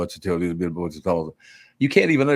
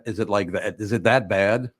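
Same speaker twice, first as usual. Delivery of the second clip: ordinary delivery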